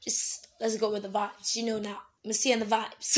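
ordinary talk, nothing marked

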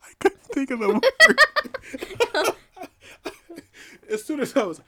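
high, slow laughing words; words squeezed out through laughter; laughing hard. Real, not acted. Laughing at losing the word.

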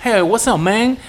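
high, loud American-English imitation; hammed-up American accent; gringo American accent. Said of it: "el inglés gringo".

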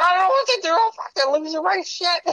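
high panicky mock voice of a coked-up friend; panicked Runkle impression. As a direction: play a man losing it over cocaine, voice shooting way up. Runkle freaking out in retold scene.

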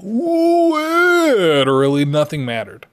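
high, hugely drawn-out wail on "Literally"; hammed-up wailing exasperation; over-the-top mock dismissal. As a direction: stretch it absurdly long, then slide down. Exaggerated repeat of his catchphrase.

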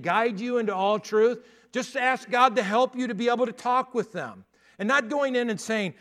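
high, loud, driving pulpit delivery; full-force preaching. Acted. Pastor urges the youth to talk to struggling friends, preaching hard. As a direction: lift the room and press every line.

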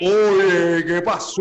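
Cuban accent imitation. Locals imitating a Cuban, in a loud mock Cuban accent. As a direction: belt it out like a teasing Cuban. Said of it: "imitan como cubano".

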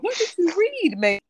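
high, loud panicked question; panicked disbelief. Retold or imitated. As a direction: panic over what she read. Retold panicked inner voice.

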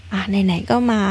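ordinary delivery, nothing marked

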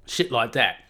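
normal talking voice

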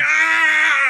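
loud, high imitated scream; mock scream. Mimicking Keanu tortured with headphones on, mock scream. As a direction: scream like a tortured movie character. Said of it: "he's just screaming".

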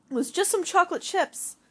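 high, loud protesting cry; yelled. Acted. Daughter protesting mom's punishment, loud yell. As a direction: yell it back at her. Daughter yelling back at mom.

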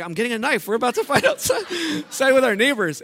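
put-on laughing at his own childhood boast. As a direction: giggle through the child's plan.

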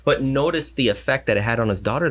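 ordinary talk, nothing marked

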